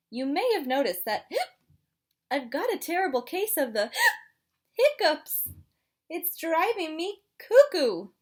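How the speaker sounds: hiccupy little penguin, gasping; chatty penguin with hiccups; penguin character voice. Acted.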